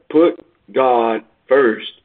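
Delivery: very slow, each word weighed; preacher's slow refrain, Put God first; slow emphatic refrain. Acted.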